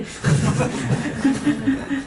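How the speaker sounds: a quiet, airy chuckle; real amused laugh; soft breathy laugh. Real, not acted. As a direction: laugh under your breath at the joke. Preacher laughs at his own joke.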